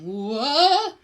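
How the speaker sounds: loud startled whoa. Acted. Arnold slipping on the rock, startled yelp. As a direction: yelp as the foot slides.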